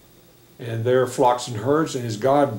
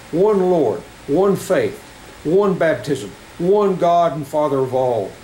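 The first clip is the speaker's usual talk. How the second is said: usual speaking voice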